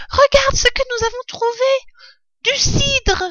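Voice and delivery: put-on squeaky-high, loud excited child; exclaiming fox cub. A narrator's reading. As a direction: cry out in thrilled little-cub voice. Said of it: "s'écria-t-il". (renardeau qui s'écrie, voix suraiguë)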